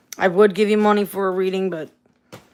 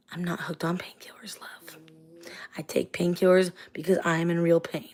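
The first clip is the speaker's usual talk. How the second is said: whispering; 4 semitones lower, 9 dB quieter than usual. Real, not acted.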